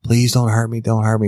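high, frightened plea, imitated; freaking out. Driver begging her captor, imitated as freaking out. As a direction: act out a panicked victim begging. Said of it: "And she's freaking out".

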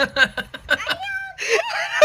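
high squealing laugh; real shrieking laughing fit; dying of laughter. Real, not acted. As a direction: laugh until it nearly cries. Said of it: "I'm gonna die from laughter".

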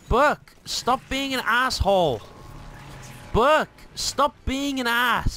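slow, high, drawn-out scold. Real, not acted. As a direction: drag the words out, scolding. Scolding the AI driver.

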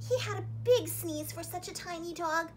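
ordinary delivery

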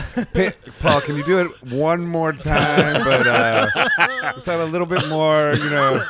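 laughing, hammed-up British producer voice; mock English accent. To Paul in the booth, a laughing mock English accent. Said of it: "Did you slip into an English accent?".

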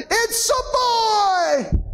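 high, drawn-out proud announcement; joyful shout. Retold or imitated. New father announces the birth to everyone around, joyful shout. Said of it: "he shouted out".

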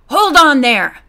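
loud, sharp order to halt; sharp command. A narrator's reading. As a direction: stop a fugitive with authority. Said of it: "commanded sharply".

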